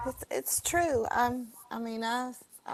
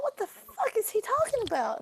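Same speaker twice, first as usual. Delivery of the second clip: much higher, incredulous inner thought; stunned disbelief; 13 semitones higher than usual. Retold or imitated. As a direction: sound floored by what you just heard. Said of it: "I thought".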